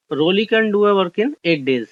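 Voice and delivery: very slow, word-by-word reading of the question. Shown as a demonstration. Teacher reading an exam question to the class, slow and deliberate. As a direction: read it out slowly so students can follow.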